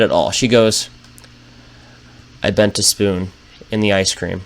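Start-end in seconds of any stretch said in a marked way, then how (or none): 2.4-4.4 quiet and flat. Retold or imitated. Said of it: "she just gets real quiet".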